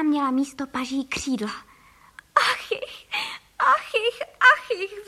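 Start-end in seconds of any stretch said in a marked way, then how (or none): 2.3-5.1 high-pitched wailing sobs; sobbing. A narrator's reading. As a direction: sob it out as the distraught wife. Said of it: "vzlikala paní Frejková". (vzlykavě naříkat)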